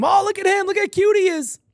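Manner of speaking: high, sing-song mock coo over "cute". Streamer mock-cooing at an imagined costumed chimp.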